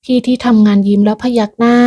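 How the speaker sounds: ordinary delivery, nothing marked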